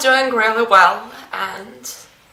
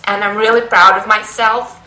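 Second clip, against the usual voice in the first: usual speaking voice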